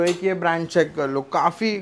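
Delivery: ordinary delivery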